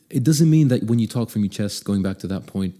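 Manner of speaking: normal talking voice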